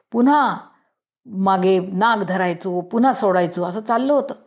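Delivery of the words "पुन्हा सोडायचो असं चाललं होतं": usual speaking voice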